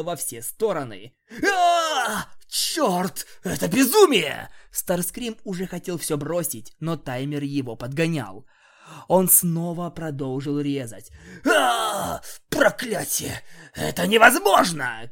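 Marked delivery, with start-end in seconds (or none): 1.5-4.5 pained scream. Acted. Cutting himself with scalpel. 11.5-15.1 loud high pained cries; screaming in pain. Acted. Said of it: "кричал он".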